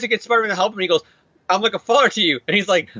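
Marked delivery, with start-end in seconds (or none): 1.5-2.4 lightly acted appeal, pitched higher; mock pleading. Villain pleads with hero for help, mock-pleading tone. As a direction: wheedle a little, higher voice.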